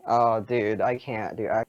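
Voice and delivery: usual speaking voice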